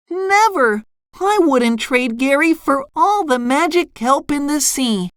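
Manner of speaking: high, defiant cartoon voice; SpongeBob exclaiming. A narrator's reading. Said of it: "SpongeBob exclaimed".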